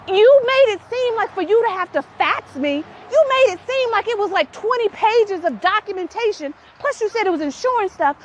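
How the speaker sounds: very high, exasperated protest; squeaky-high aggrieved retelling; indignant accusation. Retold or imitated. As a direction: accuse mom in comic outrage. Acted accusation to mom.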